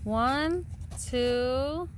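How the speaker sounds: ordinary delivery, nothing marked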